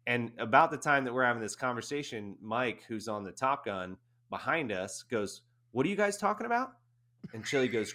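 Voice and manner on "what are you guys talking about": high, fast called-out question; calling over. Retold or imitated. Teammate behind them calling over, fast and high. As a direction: shout it over from the next vehicle.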